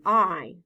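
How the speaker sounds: "I" held as a long vowel; long I sound. Shown as a demonstration. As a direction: stretch the I clearly. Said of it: "long I sound".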